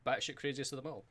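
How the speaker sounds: ordinary talk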